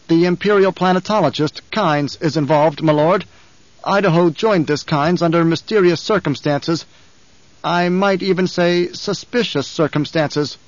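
a frightened underling hurrying to please; anxious guard captain. Acted. Captain voiced through report.

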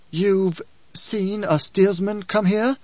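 high, slow, fearful question; startled disbelief, pitched high and drawn out; sudden fear. A narrator's reading. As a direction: ask it slowly in a high voice, dry-mouthed with dread. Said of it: "Her mouth dry with sudden fear".

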